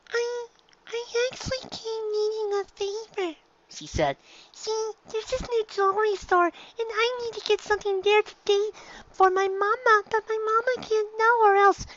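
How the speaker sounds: put-on high little-girl voice; filly voice. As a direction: talk like a small filly.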